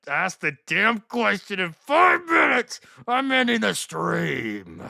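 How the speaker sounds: loud, drawn-out exasperated threat; fed-up shouting. Real, not acted. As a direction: shout it slowly, pitch climbing. Threatens to end the stream, fed up.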